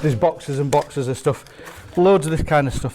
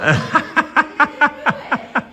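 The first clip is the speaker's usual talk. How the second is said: high laugh; 12 semitones higher than usual. Real, not acted. [name] laughs.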